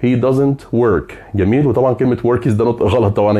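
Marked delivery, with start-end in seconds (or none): 0.0-1.1 slow correct model. Shown as a demonstration. Teacher to students, modelling the correct sentence: very slow and clear. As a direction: say "He doesn't work" very slowly, word by word, falling at the end. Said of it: "مش he don't works".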